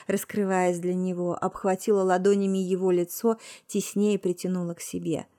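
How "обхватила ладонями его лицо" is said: ordinary delivery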